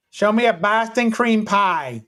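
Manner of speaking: slow, deliberate command to the phone. Acted. Asking the phone to search, slow deliberate command. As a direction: spell it out slowly for the assistant.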